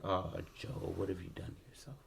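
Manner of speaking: quiet, tender dismay; hushed concern. Acted. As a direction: softly fret over someone hurt. Acted woman tending to wounded Joe.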